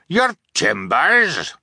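high, drawn-out incredulous cry; captain crying back. A narrator's reading. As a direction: throw it back slowly in disbelief. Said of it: "the captain cried back".

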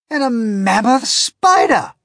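high, loud, stammering disbelief; captain can't believe his eyes; astonished captain. A narrator's reading. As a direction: stumble over what the telescope shows. Captain keeps reporting creatures.

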